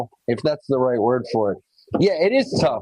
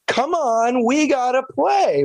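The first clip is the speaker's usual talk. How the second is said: put-on bright, much higher call to a toddler; chirpy encouragement, pitched way up; cheerful coaxing; 11 semitones higher, slower than usual. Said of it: "pulled her aside and said".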